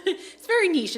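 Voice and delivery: "very niche" laughed out high and loud; laughing. Real, not acted. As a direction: laugh as you repeat "very niche". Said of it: "that's what I was about to ask".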